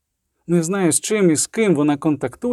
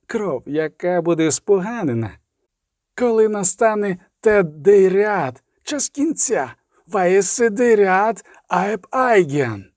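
high, drawn-out prophecy; prophetic voice; 5 semitones higher than usual. A narrator's reading. Voice foretelling the time of the end, high solemn intoning. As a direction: intone a doom prophecy, raised in pitch.

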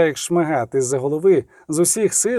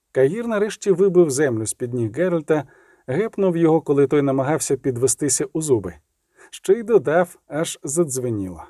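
plain reading voice

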